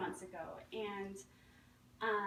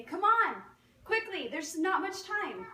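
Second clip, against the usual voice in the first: shouted, higher, urgent, slower on the second line; urgent rescuer call; 8 semitones higher, 8 dB louder, slower than usual. Acted. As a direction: call him off the roof. Rescuers shout to man on roof.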